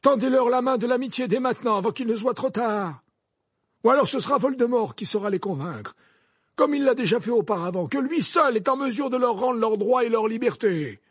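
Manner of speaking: high, loud, insistent defence; forceful headmaster. Acted.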